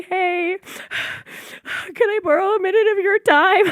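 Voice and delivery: high, loud mock-polite voice; sheepish mock politeness. Imitating herself asking stepmother, high and mock-polite. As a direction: play it up as a sheepish ask.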